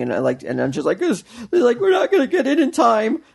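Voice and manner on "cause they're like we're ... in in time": put-on upset kids. Retold kids' tearful worry.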